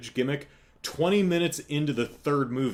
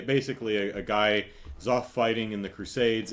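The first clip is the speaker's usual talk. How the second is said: ordinary talk, nothing marked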